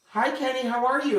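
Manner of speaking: high, sing-song careful hello; gentle greeting. Retold or imitated. Husband gently greets confused Kenny, high and careful. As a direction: greet him like a fragile patient, lilting up high.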